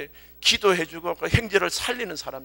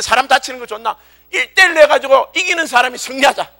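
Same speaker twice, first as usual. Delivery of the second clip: Goliath; 5 semitones higher, 7 dB louder than usual. Acted. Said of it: "골리앗이라는 친구가 한 이 미터가".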